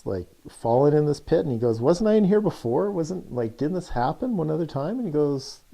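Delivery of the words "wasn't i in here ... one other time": a touch of indignant disbelief, higher; half-hearted huff at falling in again; mild retold anger. Man in the pit, mildly annoyed at himself. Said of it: "angry and pissed off".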